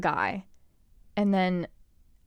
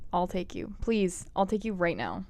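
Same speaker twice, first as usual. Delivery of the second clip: normal talking voice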